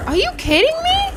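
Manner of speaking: high, rising squeal of disbelief; quick, high-pitched incredulous question. Real, not acted. Player about to go crazy, incredulous. Game going badly, disbelief.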